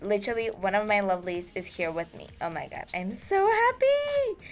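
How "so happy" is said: high, slow, stretched-out happy squeal; drawn-out gleeful high pitch; overjoyed squeal. Real, not acted. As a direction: let the joy lift it way up. Overjoyed fan joined.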